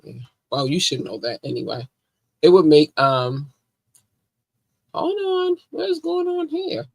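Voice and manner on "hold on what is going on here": surprised. Real, not acted. Sudden confused interruption.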